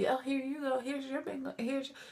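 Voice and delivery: mock-sweet, handing her the bingo; imitating him handing it over. Imitating the man handing Irene her bingo, high and soft. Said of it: "He was".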